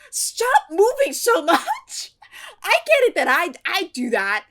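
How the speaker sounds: loud, high, worked-up rant at the screen; riled-up yelling. Real, not acted. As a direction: yell at the cartoon burger in disbelief. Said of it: "I'm getting so riled up".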